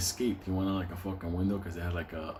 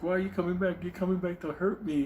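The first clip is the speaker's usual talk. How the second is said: much higher, frightened pleading; scared whine; 9 semitones higher than usual. Retold or imitated. The half-naked guy afraid Benny came back to hurt him.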